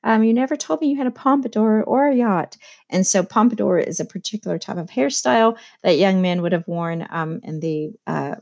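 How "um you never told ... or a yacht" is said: lightly imitated gushing Daisy, pitched up; mildly acted, higher-pitched exclamation; voicing Daisy. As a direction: quote her with a high "oh" and a lift. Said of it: "So Daisy's like".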